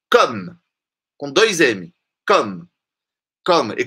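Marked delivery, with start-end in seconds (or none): none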